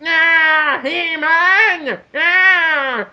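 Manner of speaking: high cartoon villain screech; wailing skeleton villain. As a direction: cry out like a cackling skeleton villain. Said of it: "yellow skeleton blue man who's going".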